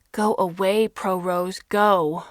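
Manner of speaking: loud, angry shout at the reporters; girl shouting go away. A narrator's reading. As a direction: yell them off the porch. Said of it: "Amy shouts".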